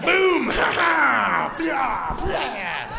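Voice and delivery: high, loud boom and cackle; mock triumphant shout. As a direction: slam it down like a victory. Acts out proposal.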